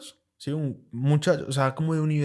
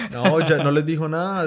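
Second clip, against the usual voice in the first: laughing loudly while speaking; loud real laughter into speech; 4 semitones higher, 5 dB louder than usual. Real, not acted. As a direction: laugh through the words. Laughing at friend's joke.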